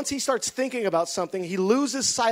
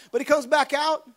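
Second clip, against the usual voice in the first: ordinary delivery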